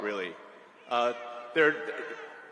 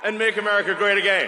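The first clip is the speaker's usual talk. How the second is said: loud rally cry; 5 semitones higher, 9 dB louder, faster than usual. Real, not acted. Rally slogan, crowd cheering.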